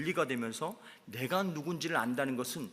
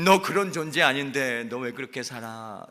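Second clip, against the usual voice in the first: firm rebuke; 8 dB louder than usual. Acted. God rebuking after showing love.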